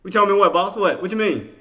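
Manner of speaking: loud, high mock challenge; mock indignation. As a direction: pretend to take offence and fire back loudly. Challenges viewer's "I told you.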